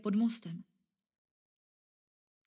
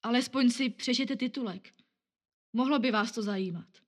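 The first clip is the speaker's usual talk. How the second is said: higher, louder coaxing plea; persuading; 4 semitones higher, 5 dB louder than usual. Acted. Newspaper girl coaxes the man, higher and louder. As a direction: talk him into reading it. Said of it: "Pokoušela se ho přesvědčit".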